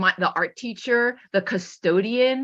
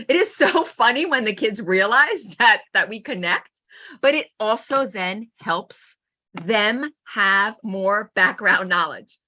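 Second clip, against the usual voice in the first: ordinary delivery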